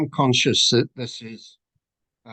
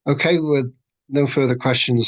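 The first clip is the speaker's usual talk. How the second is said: ordinary talk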